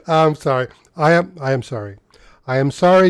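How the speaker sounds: ordinary delivery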